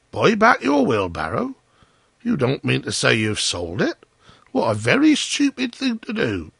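high-pitched disbelieving questions; astonished miller. Acted. Miller learning Hans sold his wheelbarrow, scandalised. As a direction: scold him in swooping disbelief.

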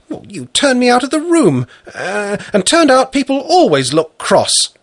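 put-on high childlike voice; little girl voice. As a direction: answer like a small girl. Little girl answers father.

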